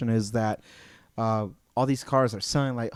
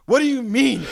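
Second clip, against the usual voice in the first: mock disbelief; 9 semitones higher, 5 dB louder than usual. Mock shock at dealer.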